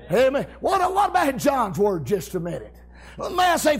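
usual speaking voice

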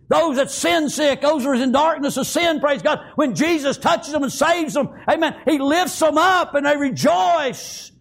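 high, sustained pulpit fervour; full-force preaching. Acted. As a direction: preach it in driving, high-pitched waves.

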